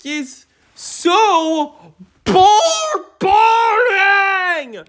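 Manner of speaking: high-pitched shrieking rant, loud; frustrated screaming. Real, not acted. Reviewer screaming at a boring movie, shrill and loud. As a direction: yell it out, fed up and exasperated. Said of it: "did not hear me screaming out loud or yelling".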